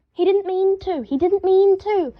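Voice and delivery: called out high and loud, pleading; yelled. A narrator's reading. Harry defending the ferret from inside, yelling. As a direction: yell it from another room. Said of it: "Harry yelled from inside".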